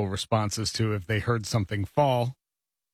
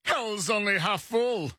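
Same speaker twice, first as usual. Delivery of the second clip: high, slow mock holler; mock shouting; 10 semitones higher, slower than usual. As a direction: stretch it out like a far-carrying call. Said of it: "would have been shouted from one Model T to another".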